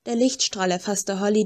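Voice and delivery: ordinary delivery, nothing marked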